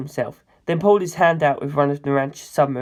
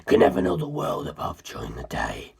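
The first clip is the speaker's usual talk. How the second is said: low, hushed, menacing whisper throughout; a cold ancient being confessing in a low whisper; ancient predator's whisper; 13 semitones lower, 7 dB quieter than usual. Acted. Cars telling the narrator his history, low cold whisper.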